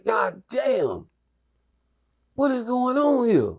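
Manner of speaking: high-pitched, astonished exclamation; retold amazement. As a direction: replay being floored by what you saw. Acted amazement in the story.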